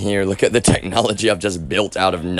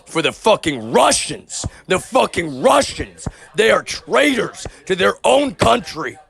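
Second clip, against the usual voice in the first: real outrage, pitch climbing high; angry rant rising in pitch to the end; outraged; 8 semitones higher than usual. Real, not acted. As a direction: denounce them, voice pitched up. Angry political rant about betrayal.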